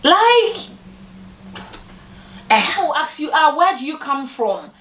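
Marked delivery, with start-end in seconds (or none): none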